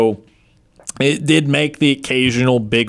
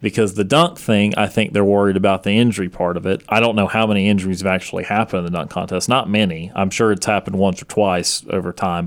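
ordinary talk

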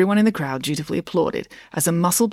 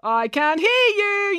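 high, very drawn-out taunt; yelling; much slower than usual. A narrator's reading. Brad to the residents of Currawong, yelling. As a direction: goad the crowd into more noise. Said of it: "yelled Brad".

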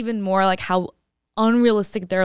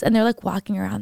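ordinary delivery

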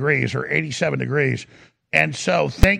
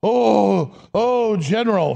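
higher, drawn-out sycophantic gushing; fawning mock gush; 4 semitones higher, much slower than usual. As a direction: gush over him like a courtier. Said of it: "here he is like".

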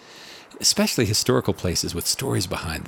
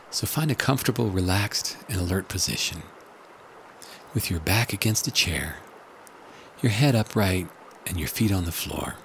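hushed and calm, guiding relaxation. Acted. Leads a guided meditation.

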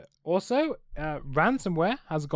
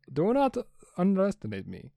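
put-on light Russian accent, a bit higher; 5 semitones higher than usual. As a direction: threaten in a hammed-up Russian accent. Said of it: "Do not try and do Russian accent".

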